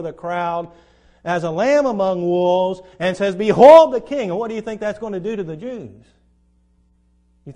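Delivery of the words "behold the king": much louder, ringing announcement; loud proclamation. Acted. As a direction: present him to the whole crowd. Pilate presents Jesus to crowd.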